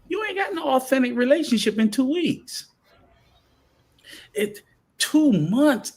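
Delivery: high, loud, insistent; rising exasperation about two-week flings; worked-up rant. Real, not acted. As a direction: hammer the point home, exasperated. Heated rant about fake relationships.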